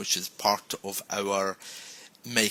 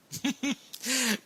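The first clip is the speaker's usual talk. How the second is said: high giggle; 4 dB quieter than usual. Real, not acted. Laughs after demo.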